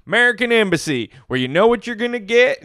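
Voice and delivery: high sarcastic impression; high, teasing quote voice; high mocking voice. Mocking couple's McDonald's quip.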